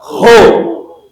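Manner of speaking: isolated, loud "Ho"; syllable demo. Shown as a demonstration. Teacher names the second syllable, loud isolated "Ho". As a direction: sound out "Ho" alone as one syllable. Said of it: "La deuxième syllabe".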